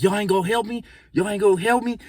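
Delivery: high, pleading whine; pleading for help. Retold or imitated. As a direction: beg your friends from midair. Friend pleading while lifted midair.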